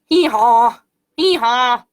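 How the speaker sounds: loud two-part bray; donkey hee-haw. Pretend. Showing children what donkeys say, loud playful bray. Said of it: "What do donkeys say?".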